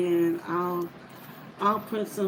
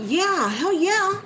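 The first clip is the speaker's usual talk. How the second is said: high, loud and drawn out with a laugh; delighted whoop; gleeful; 11 semitones higher, 5 dB louder, slower than usual. Real, not acted. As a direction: crow it out, thrilled.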